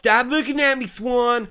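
imitated stupid comic voice, high; Billy Madison. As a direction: silly mouth voice, like a petulant goof. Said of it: "do that stupid mouth thing then".

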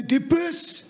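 high, mock-offended "what did you say"; mock indignant driver. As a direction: act out his outraged disbelief.

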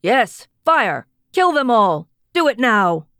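loud, fierce battle order; commander yelling. A narrator's reading. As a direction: yell the order to fire. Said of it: "the man in charge yelled".

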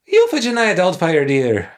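high, loud Scottish nanny impression; Missus Doubtfire. Podcaster answering co-host's comparison, high Missus Doubtfire impression. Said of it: "like, a Missus Doubtfire".

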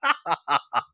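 high chuckle burst; laughing. Real, not acted. Host cracking up after teasing a listener, cackling. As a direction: laugh at your own joke.

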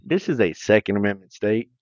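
put-on Southern accent. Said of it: "talking real slow".

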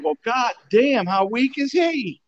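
high, slow mock of Lou's drawl; lightly mimicked Lou, slow and high; Lou impression. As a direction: stretch it out high like an old coach teasing. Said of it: "Lou just looked at me and said".

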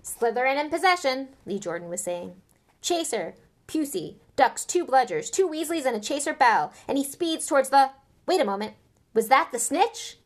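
loud, quick play-by-play; live commentary. A narrator's reading. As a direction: commentate the match excitedly.

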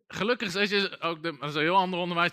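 ordinary talk, nothing marked

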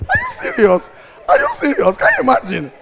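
high, loud disbelief; are you serious, shrieked high; incredulous. Real, not acted. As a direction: squeal it in disbelief.

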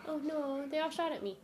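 ordinary delivery

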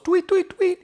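very high chirpy tweet tweet tweet; birds tweeting. Pretend. Teller chirping like the helpful little birds. Birds help Bernie escape.

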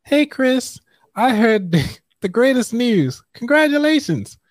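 high-pitched female voice; woman voice. Acted. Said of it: "Let me do my woman voice".

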